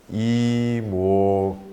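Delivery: "Imoko" said very slowly, each syllable clear; Korean phrase. Shown as a demonstration. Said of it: "we're gonna say in Korean".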